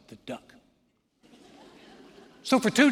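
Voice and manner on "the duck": hushed threat. Retold or imitated. Sister reminding her brother of the secret, menacing. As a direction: drop the volume for a sly reminder.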